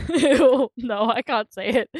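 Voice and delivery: loud, giggling ew; laughing cringe. Real, not acted. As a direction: squirm and laugh it off. Refusing to do the voice.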